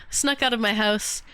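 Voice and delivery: ordinary talk, nothing marked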